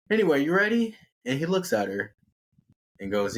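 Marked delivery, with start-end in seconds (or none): 0.1-1.0 put-on very high, entitled young woman; girlish voice. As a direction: squeak it out, spoiled and sure. Amy's voice in the story.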